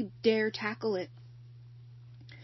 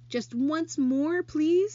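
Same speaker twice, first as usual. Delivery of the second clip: pleading; 8 semitones higher, 8 dB louder, much slower than usual. Acted. Charlotte pleads with rat.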